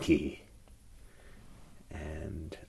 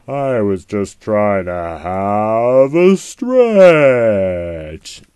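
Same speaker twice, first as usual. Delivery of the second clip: put-on slow, louder old lion complaint; 10 dB louder, much slower than usual. A narrator's reading. Old lion complains to the forest, slow and loud. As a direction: drag it out like a tired old lion. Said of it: "said the old lion".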